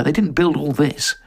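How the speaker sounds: usual speaking voice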